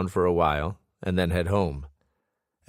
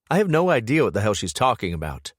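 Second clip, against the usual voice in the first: ordinary delivery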